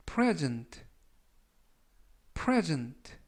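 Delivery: "present" stressed on the first syllable, PRE-sent; model pronunciation. Shown as a demonstration. Teacher modelling the noun "present" for students. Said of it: "present라고 발음하시면".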